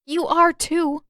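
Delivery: insistent retort. Acted. Katara contradicts Zuko.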